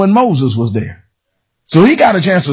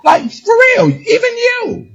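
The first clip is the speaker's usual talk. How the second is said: much higher, slow, crying shout of "even you"; mean it. Shown as a demonstration. Preacher shows the church how to say it, crying out high. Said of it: "You gotta say it like you mean it".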